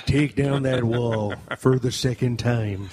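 very slow mock presidential speech; slow, solemn Reagan send-up; Ronald Reagan. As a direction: stretch each word like a hammed-up statesman.

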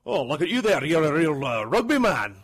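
hearty imitation of a Scottish accent; Scottish bartender. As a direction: boom it out as a Scottish barman. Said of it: "when I was in Scotland".

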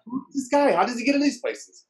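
high-pitched baffled inner voice; imitated bewilderment. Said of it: "a look in his face like".

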